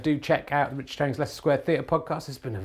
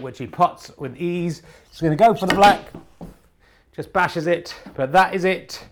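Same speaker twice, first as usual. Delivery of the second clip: brisk, raised snooker commentator voice; live sports commentary; 5 semitones higher, 7 dB louder than usual. Acted. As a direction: call the frame live like TV commentary.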